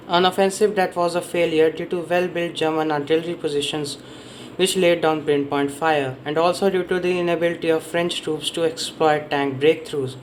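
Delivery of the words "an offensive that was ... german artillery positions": normal talking voice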